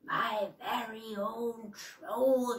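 slow, drawn-out gloating witch; gloating raspy witch; gleeful pronouncement. Acted. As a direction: savour each word like a prize. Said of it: "she pronounced".

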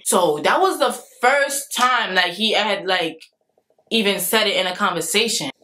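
ordinary talk, nothing marked

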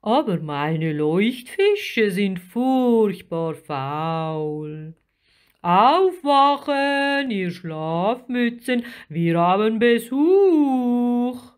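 slow giant grumbling, then loudly rousing sleepers; huge creature calling out, drawn-out; deep creature voice. A narrator's reading. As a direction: stretch the vowels, swelling to a big wake-up call. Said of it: "eine tiefe Stimme".

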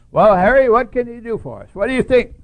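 high, loud boss demanding an answer; loud chairman. Retold or imitated. Chairman in the board meeting asks Harry loudly. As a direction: call out the question, high and loud. Chairman asking, voiced in story.

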